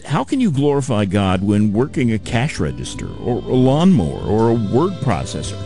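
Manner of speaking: normal talking voice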